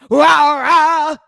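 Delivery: loud mock monster roar; hammed-up shouted growl. YouTuber mocking a rapper's gloomy stage act, roaring. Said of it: "Here come [name]".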